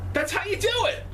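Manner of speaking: high thrilled cry; excited exclaim. Real, not acted. Fan cheering the wrestling segment, excited.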